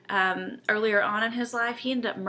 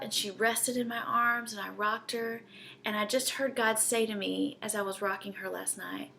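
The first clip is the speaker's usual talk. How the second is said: ordinary talk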